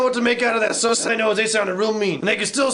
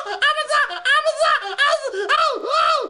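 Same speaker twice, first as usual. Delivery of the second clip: very high-pitched, squawking cartoon voice; oddball bear; 13 semitones higher, slower than usual. The bear nobody invites to parties, high squawking. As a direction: shriek it up high like a silly creature. Odd bear's turn.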